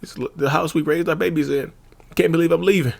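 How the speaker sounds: high, tearful imitation of someone leaving home; hammed-up weepy voice, pitched high; mock crying. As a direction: pretend to cry over leaving the house. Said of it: "they'll start crying and then they'll get hyped".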